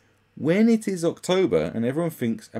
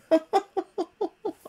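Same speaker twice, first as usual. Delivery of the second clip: shrill put-on cackle far up the range; mocking high giggle; 4 dB louder than usual. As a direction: giggle like a smug fool. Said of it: "they go".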